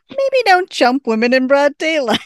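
loud, high quote breaking into laughter; laughing mock scolding. Host retells Beth's jibe at Waverly, breaking up laughing. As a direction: deliver the jibe loudly, losing it to laughter. Cracks up voicing Beth's line.

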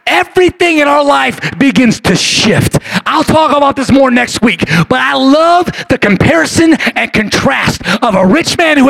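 loud, ringing pulpit voice; preaching at full force. Acted. Preacher stirring the congregation, loud and fervent. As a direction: preach it out big to the room.

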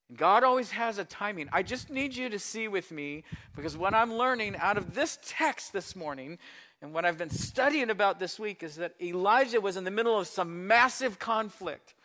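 ordinary talk, nothing marked

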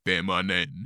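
feminine dropped low and quiet; deep voice. Retold or imitated. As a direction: sink deep and soft on feminine. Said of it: "that's her, like, voice too".

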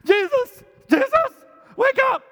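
high, loud frantic calling; panicked cry. Retold or imitated. Disciple panicking, waking Jesus in the storm, frantic yell. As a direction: yell to wake him in terror.

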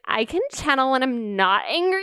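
hammed-up Valley Girl, lording it over someone. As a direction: put on a smug Valley Girl lilt. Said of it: "That was a great Valley Girl voice".

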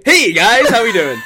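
high, bright, loud influencer greeting; Instagram blogger voice. Retold or imitated. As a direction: chirp it like a peppy Instagram blogger. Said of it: "Sound like one of those Instagram bloggers".

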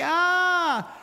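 a yelp of instant regret; dismayed cry. Retold or imitated. Realizing how he sounded, a high cry of dismay.

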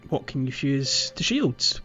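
ordinary talk

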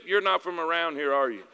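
low, subdued mock-Northerner reply; imitating reserved Northerners. Reserved locals answering the Southern visitor, imitated low and subdued. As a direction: drop it low and dry, imitating reserved folks. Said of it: "Them folks are reserved".

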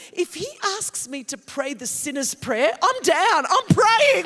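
loud, high, eager declaration building to a shout; ready to pray right now; eager inner voice. Retold or imitated. Woman thinking she'd pray the sinner's prayer, eager and loud. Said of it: "I'm thinking".

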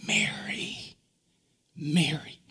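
tender unvoiced whisper, twice; whispered name. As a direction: call her name in a hush. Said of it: "And Jesus says".

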